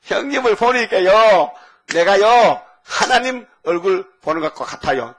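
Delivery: high, loud, slow fawning plea; butter up your big brother; sly flattering Jacob. Retold or imitated. Jacob flattering his brother Esau, fawning and slow. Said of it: "야곱이 하는 말이 참 간사하다".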